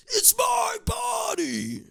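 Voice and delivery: high, slow shrieking yell of "it's my body"; mock scream. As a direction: screech it like a hammed-up tantrum. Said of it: "Just start yelling".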